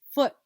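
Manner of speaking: FOOT, tiny gap, then T; final sound isolated. Shown as a demonstration. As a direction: set the T of FOOT apart. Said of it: "Final sound is".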